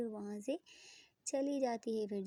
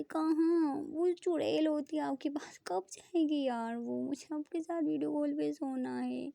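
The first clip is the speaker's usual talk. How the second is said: higher, louder petulant complaint; jealous girlfriend, higher petulant grumble; jealous whine; 4 semitones higher, 6 dB louder, slower than usual. Acted. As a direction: whine about the other girl. Preeta jealous of roommate.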